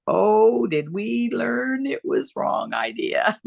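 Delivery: slow, laughing rueful admission. Real, not acted. Telling the host how wrong they were, rueful laughing. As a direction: laugh at their own mistake, drawing it out.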